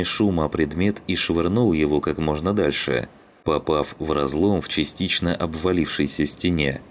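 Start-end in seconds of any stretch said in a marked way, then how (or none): none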